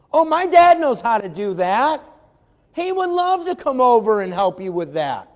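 put-on high, loud eager kid offering dad's help; eager child. Preacher voicing a child volunteering dad at the table, eager and loud. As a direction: chirp it out like a proud little kid.

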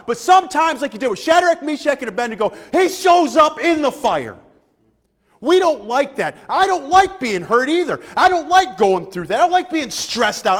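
loud, rising preacher cadence; preaching at full force. Acted. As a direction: preach it out to the church, pushing hard.

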